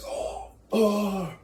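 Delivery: high, soft, hammed-up groans of a stabbed man; stabbed king's high, soft mock cry of pain. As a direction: squeak out a dying "oh, oh". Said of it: "He's like".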